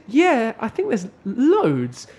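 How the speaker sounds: high, loud, drawn-out retold answer; mock exasperation. Brit answering coworker's naive question, mock exasperated. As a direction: stretch it out as if obvious.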